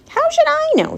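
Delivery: very high, indignant squawk; exasperated retort. A narrator's reading. Rat asked to explain the words, exasperated. Rat snaps back at Charlotte.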